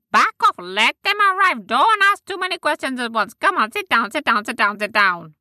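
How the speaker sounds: high, firm creature voice; high creature leader calming the crowd; bossy fairy leader. Acted. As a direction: shoo the others back, then settle them. Leader tells others back off.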